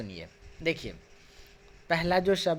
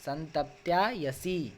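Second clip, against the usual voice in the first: slow model reading. Shown as a demonstration. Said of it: "सन एक बार में बोल लीजिए".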